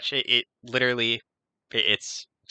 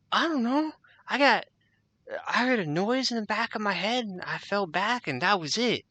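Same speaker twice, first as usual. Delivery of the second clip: higher, bewildered guard voice; a lost henchman, baffled and whining; confused guard; 13 semitones higher than usual. Acted. Guard answering his partner, baffled and high.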